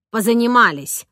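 firm closing statement; nanny sending the tutor away; dismissive. A narrator's reading. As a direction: end the lesson with finality.

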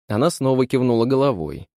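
usual speaking voice

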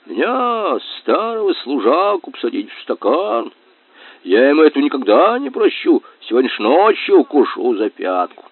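обиженное ворчание старого вояки, с высокими всплесками; возмущайся с нажимом, выделяя обиду; возмущение старого служаки. A narrator's reading. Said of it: "возмущённо раздувал щёки". (возмущённо)

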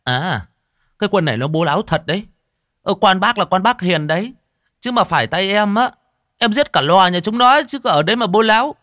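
high, fast, loud blustering; puffed-up, heated threat; indignant anger. A narrator's reading. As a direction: bluster in offended outrage, voice pitched high. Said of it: "nghe thấy thế thì tức khí".

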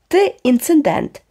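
slow, high contemptuous insult; scornful. A narrator's reading. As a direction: look down on him as you say it. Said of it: "презирливо мовив Волтер".

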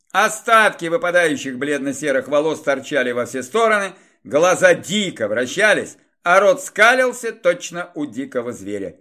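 usual speaking voice

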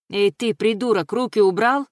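acted angry yell, rising at the end; shouted warning. A narrator's reading. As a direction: call out sharply to stop an attacker. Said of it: "что это она крикнула".

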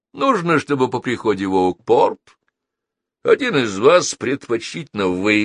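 high-pitched courteous gentleman villain; suave villain, politely persuasive. Acted. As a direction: lay out the demand with oily politeness.

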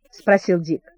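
plain reading voice

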